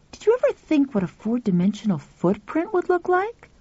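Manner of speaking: put-on boy voice. Pal speaks.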